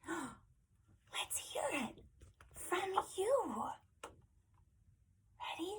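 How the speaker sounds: breath in, then soft high whisper; hushed invitation. Pretend. Reader invites children to say hello, hushed and eager. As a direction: whisper it to build anticipation.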